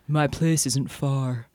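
slow, low seductive man's voice; deep baritone voice. Acted. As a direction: purr it out like a smooth stranger. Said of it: "A deep baritone voice said".

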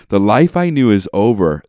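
repeated line, just as heavy and loud; hammed-up tragic reading with weight on "I"; mock-solemn re-read; dramatic lyric recitation. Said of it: "it's so intense".

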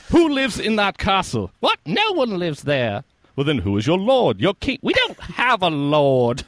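high, loud quoted dialogue; Holy Grail scene. Retold or imitated. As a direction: play the peasant and king. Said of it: "one of the things I quote".

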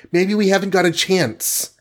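high, anxious heroine voice; high-pitched worried plea; alarmed gasp. A narrator's reading. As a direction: voice her doubt high and fearful. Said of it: "gasped the Wasp".

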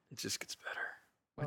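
slow, ominous whisper. Acted. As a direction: breathe it out slowly, eerie. Hushed repeat of the phrase.

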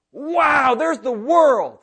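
high, loud, slow gasp of wonder at the world; awed distraction. Retold or imitated. Preacher mocking a distracted believer, loud awed wonder. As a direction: gape at it like a dazzled child. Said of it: "you in your life might say".

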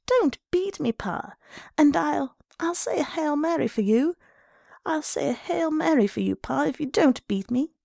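put-on high, pleading child voice; shaking with fright. A narrator's reading. Boy begging his father to stop beating him, frightened. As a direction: beg desperately as a scared boy. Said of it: "his voice shook with fright".

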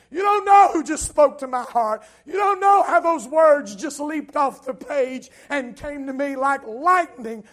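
loud, high, preached exultation; overjoyed testimony. Acted. Believer answering "what's wrong with you", overjoyed testimony. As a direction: exult loudly, as if just out of prayer. Acted reply of an overcome believer.

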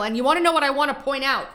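ordinary talk

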